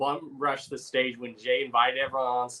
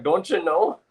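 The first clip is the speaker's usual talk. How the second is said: higher-pitched mock Minnesotan accent; Minnesota accent; 6 semitones higher, slower than usual. Quoting her coworker from Minnesota, Minnesota accent. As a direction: imitate a Minnesotan's lilting accent. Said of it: "her accent would come out strong".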